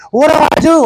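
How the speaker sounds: the friend alarmed, asking for help; alarmed question. Retold or imitated.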